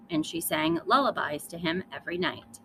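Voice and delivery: usual speaking voice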